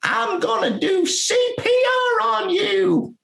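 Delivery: a wife yelling at Harold in a shrill-high voice; mock high shouting wife calling her husband; wife ordering husband. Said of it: "then you hear this voice say".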